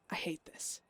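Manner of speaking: hushed, unvoiced confession; bitter whisper. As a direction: breathe it out in a whisper. Whispered line in journal.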